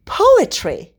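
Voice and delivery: king rounding on his servant, outraged; exasperated outburst. A narrator's reading. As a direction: throw the word back at him, outraged. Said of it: "he hissed".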